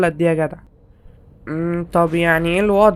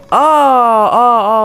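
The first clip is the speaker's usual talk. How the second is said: startled cry; 4 semitones higher, 6 dB louder, much slower than usual. Real, not acted. Character jolted by in-game event.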